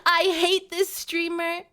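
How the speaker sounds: hammed-up sobbing imitation of upset fans; loud, high, whiny fake crying; mock wailing. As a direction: wail melodramatically, making fun of them. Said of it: "And suddenly it's, oh, woe is me".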